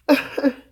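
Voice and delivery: short high giggle; high laugh. Real, not acted. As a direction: burst of embarrassed laughter. Laughs at herself.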